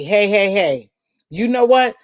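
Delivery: ordinary talk, nothing marked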